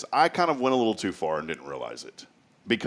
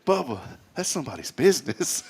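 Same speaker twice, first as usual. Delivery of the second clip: quoting Billy; 4 semitones higher than usual. Retold or imitated.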